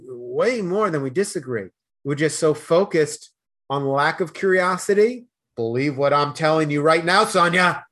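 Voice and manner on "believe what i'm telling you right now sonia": mock loud insistence. Host mocking Boston arguers, loud and pushy. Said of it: "I'm from Boston. I got a loud voice".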